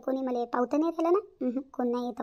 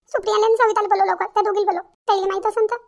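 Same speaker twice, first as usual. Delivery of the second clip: urgent mother-in-law; 7 semitones higher, 7 dB louder than usual. Acted. Mother-in-law gives orders.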